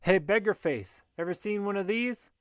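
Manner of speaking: high taunting call, lightly shouted. A narrator's reading. Anon distracting the Geist, mild high-pitched shout. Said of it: "Then I hear a shout".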